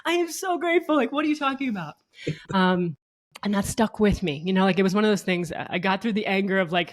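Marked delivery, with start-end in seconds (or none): 0.0-1.9 very high, defensive outrage; indignant protest. Retold or imitated. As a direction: protest you're already grateful, pitched way up. Retold indignant reply to husband.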